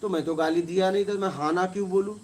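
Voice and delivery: ordinary talk, nothing marked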